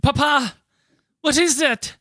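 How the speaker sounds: put-on high-pitched child asking papa; small high voice, puzzled question; child's voice asking papa. Co-host plays a child asking papa about the noise, high child voice. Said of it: "what would this have sounded like".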